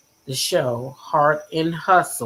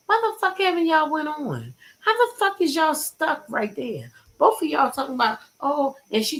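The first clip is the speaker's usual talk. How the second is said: higher-pitched disbelieving outburst; exasperated rant; 10 semitones higher than usual. Real, not acted. Streamer venting at the TV couple, exasperated. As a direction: let the frustration burst out high. Ranting at reality show couple.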